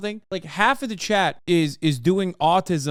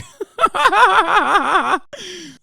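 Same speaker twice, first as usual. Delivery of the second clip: high, loud burst of laughter; 13 semitones higher, 7 dB louder than usual. Real, not acted. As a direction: cackle at the joke. Laughing at own impression.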